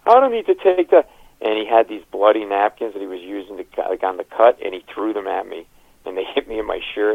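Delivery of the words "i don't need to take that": very high, loud put-on screaming; hammed-up outraged scream. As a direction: yell it like an insulted bleeding man. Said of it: "he starts screaming at me".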